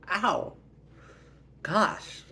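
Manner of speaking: high, loud yelp; play-acted hurt; acted pained protest. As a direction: yelp at a slap, aggrieved. Sister slapped on the head.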